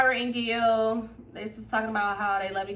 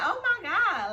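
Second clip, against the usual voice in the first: 1 shocked gasp; 10 semitones higher, 3 dB louder, slower than usual. Retold or imitated. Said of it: "I'm like".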